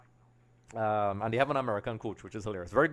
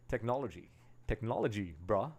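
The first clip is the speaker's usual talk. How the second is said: a hint of American accent, low and soft; slight American accent; 3 semitones lower, 7 dB quieter than usual. Real, not acted. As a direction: slip casually into a light American accent. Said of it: "I spoke an American accent there".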